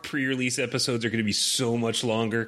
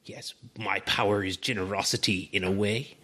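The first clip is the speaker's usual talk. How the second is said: cartoon villain voice; 6 semitones higher than usual. Continues villain voice.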